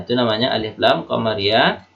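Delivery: ordinary talk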